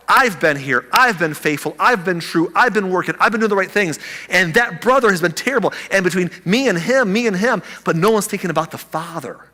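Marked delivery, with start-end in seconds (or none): 0.1-7.6 put-on a touch of the indignant older brother, pitched higher and louder; self-righteous older brother. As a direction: list your own merits with rising indignation.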